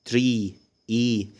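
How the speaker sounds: "تري، إي" with a slow, stretched ee; long vowel demo. Shown as a demonstration. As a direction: hold the ee of "تري", then "إي" alone. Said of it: "تري لونج فاول". ("تري، إي" بمد الصوت الطويل)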